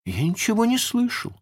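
very high-pitched character reply; pirate underling. A narrator's reading. Starkey tells Hook he heard nothing, high-voiced. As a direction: answer in a high put-on voice. Pirate answers startled captain.

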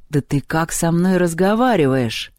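ordinary delivery, nothing marked

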